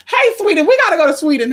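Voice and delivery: high loud shout-out; calling out. Real, not acted. Shouting greetings to Sweden excitedly. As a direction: call out to Sweden.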